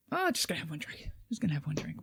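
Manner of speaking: hushed, whispered fib about one drink; sneaky whisper. Retold or imitated. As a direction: whisper it like a guilty secret.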